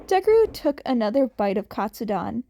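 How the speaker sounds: ordinary delivery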